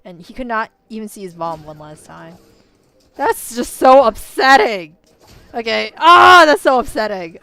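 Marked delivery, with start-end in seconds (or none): 3.2-7.4 loud, slow cry of real dismay; wailing that it's so upsetting; upset outburst. Real, not acted. Reacting to the son's fate, a loud, drawn-out wail. Distraught outburst over the ending.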